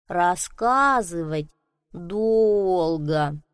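slow, sighing, weary snail. Acted. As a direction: drag it out with a sigh. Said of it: "вздохнула улитка". (медленно, со вздохом)

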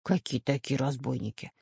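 low, soft questioning; quiet, cautious professor voice; wary professor. A narrator's reading. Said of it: "насторожился профессор Пыхтелкин".

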